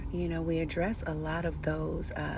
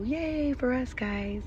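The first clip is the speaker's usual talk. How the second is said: excited; 7 semitones higher, slower than usual. Real, not acted. Cheering their own success.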